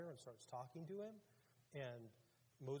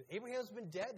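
incredulous objection; 6 semitones higher, 7 dB louder than usual. Acted. People object to Jesus, acted.

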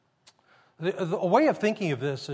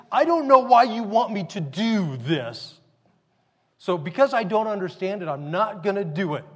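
mock arrogance; 2 semitones higher, 5 dB louder than usual. Servant refusing the master's request, mock arrogance. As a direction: imitate a petulant refusal, louder at first. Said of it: "Can you imagine the arrogance to say".

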